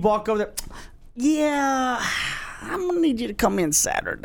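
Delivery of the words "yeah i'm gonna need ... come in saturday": Office Space boss. Retold or imitated. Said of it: "like the movie The Office".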